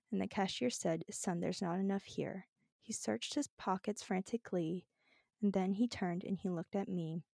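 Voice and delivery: soft, mournful storytelling; dramatic reading. Pretend. Finishing the sad song for a reluctant friend, hushed and earnest. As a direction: recite it gently, almost tearful. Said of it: "dramatic reading of these lyrics".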